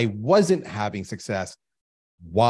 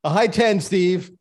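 higher, louder emphatic insistence; emphatic, upbeat answer to the host; 8 semitones higher, 7 dB louder, slower than usual. Real, not acted. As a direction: push the point with glee. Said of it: "emphatic answer".

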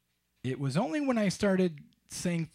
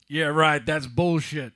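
loud, scoffing retort; a hint of an irate caller, dismissive and loud; lightly voiced Todd; 9 dB louder, slower than usual. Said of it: "Todd said".

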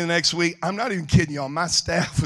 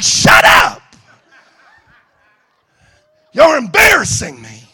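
loud, embarrassed mock scolding; exasperated shout; 6 semitones higher, 11 dB louder, slower than usual. Jesus scolds cheering disciples, exasperated shout. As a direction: yell at your over-keen entourage to stop.

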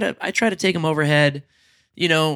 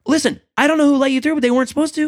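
fast, high stern agent; 10 semitones higher, 3 dB louder, much faster than usual. Retold or imitated. Agent snapping, retold.

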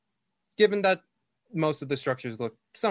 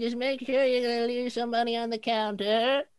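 put-on high, louder old lady voice; very old woman; 12 semitones higher, 5 dB louder than usual. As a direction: talk up to a child as a loud, high-pitched granny. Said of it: "very old lady".

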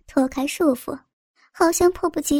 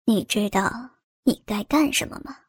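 low menacing man; 5 semitones lower, 5 dB quieter than usual. A narrator's reading. Man speaks to kneeling girl.

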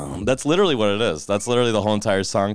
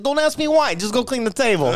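bossing the busser in a mock accent; Albanian accent; 14 semitones higher than usual. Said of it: "I said".

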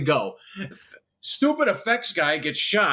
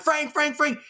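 higher retold calling out of a name; 10 semitones higher than usual. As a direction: call after him as he drives past. Calling out to passing driver.

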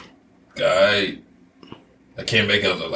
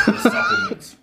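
laughing squeak. Real, not acted. Laughing defence in character.

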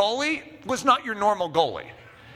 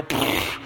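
airy lip-blown pfft; imitated puff of a mis-hit; kick sound effect. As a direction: blow out a botched-kick noise. Imitates his mis-kick of the ball.

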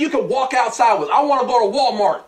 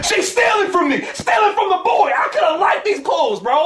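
higher, indignant complaint; mock outrage; 8 semitones higher than usual. Real, not acted. Complaining to friends about his girlfriend, mock outrage. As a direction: play up the outrage.

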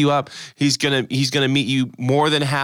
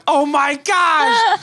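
much higher, slow, drawn-out oh my gosh; exasperated groan; 5 dB louder, much slower than usual. Retold or imitated. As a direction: listeners react to the word fumble, slow exasperated groan. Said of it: "people are listening right now going".

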